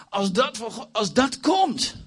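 high, rising fervour; fervent excitement. Real, not acted. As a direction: build to a high, drawn-out peak. Preacher carried away by God's power. (vurig en hoog, vol verwachting)

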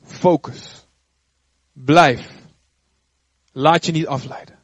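firm command. Retold or imitated. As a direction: set each word down firmly. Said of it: "God had hem gezegd". (God die streng en luid beveelt)

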